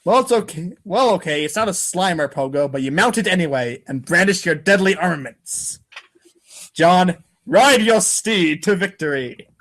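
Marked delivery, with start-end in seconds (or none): none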